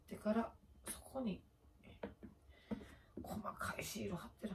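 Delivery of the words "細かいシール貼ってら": whispered under her breath. Real, not acted. Doing her makeup on camera, whispering. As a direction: half-whisper while concentrating. Explaining makeup steps to herself.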